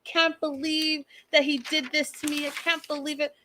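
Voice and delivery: high, softening mock-tearful complaint; mock crying. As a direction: imitate an upset mother, pitched well up. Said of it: "She starts crying".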